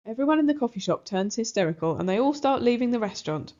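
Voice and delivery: usual speaking voice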